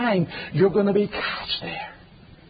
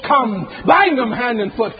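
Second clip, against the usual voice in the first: God commanding angels; 7 semitones higher, 4 dB louder than usual. Acted. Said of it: "He is calling his angels".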